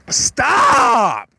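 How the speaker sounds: sudden high shout of stop. Acted. Speaker mock-yells stop at an annoying person, loud and high. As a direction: yell it sharply to cut them off. Acted outburst mid rant.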